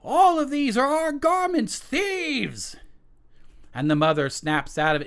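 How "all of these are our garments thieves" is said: high, loud outraged king voice; king finding his stolen clothes cries out. Acted. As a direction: cry thieves as the furious king. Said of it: "the king cries out".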